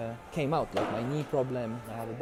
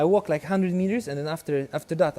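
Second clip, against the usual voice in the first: usual speaking voice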